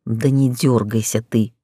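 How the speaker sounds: restrained scolding with a touch of relief; through teeth, relieved. A narrator's reading. Director tells injured heroine to keep still, low and relieved. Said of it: "процедил он, но в голосе его слышалось явное облегчение".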